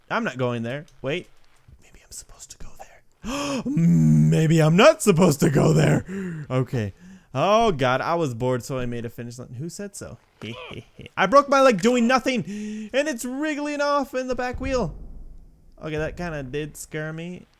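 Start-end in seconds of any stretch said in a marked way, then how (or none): 1.8-3.6 hushed, whispered pondering. Acted. Unsure where to go in game. 3.7-6.1 gasp, then loud, slow exclamation; loud realization. Acted. Reverses the whispered guess. 11.2-14.9 high-pitched incredulous whine; exasperated complaint. Real, not acted. Game character injured.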